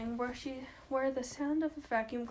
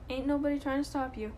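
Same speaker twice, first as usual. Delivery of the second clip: ordinary delivery, nothing marked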